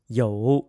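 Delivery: you dipping low then rising; third tone you. Shown as a demonstration. Teacher models you in dipping third tone. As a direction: dip you down and bring it back up. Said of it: "and with a third tone".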